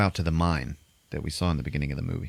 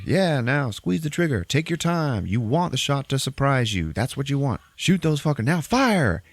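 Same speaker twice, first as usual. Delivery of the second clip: imitated firing instructor; 7 semitones higher than usual. Marksman instructing a firing line, raised coaching voice. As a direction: coach the shot, then call the order.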